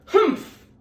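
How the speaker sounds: a loud, high scornful huff; huffy humph. Acted. Sour kangaroo scoffing at Horton, loud high huff. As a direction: huff it out with contempt. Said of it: "huffed the voice".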